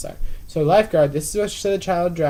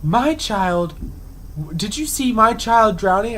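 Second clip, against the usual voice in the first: higher-pitched mock outrage; retold indignant confrontation; mad mother; 6 semitones higher, slower than usual. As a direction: imitate an accusing parent, drawing out the words. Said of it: "and she's mad".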